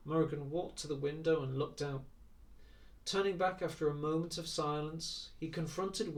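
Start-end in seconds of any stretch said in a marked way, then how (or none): none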